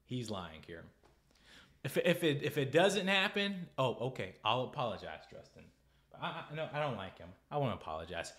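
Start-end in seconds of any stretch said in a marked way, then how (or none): none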